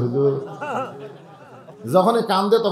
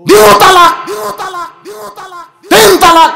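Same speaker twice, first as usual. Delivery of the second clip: much higher, louder shouted declaration; hammed-up yell for the crowd; loud comic proclamation; mock outburst; 13 semitones higher, 8 dB louder than usual. Preacher's mock divorce outburst.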